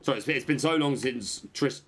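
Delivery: usual speaking voice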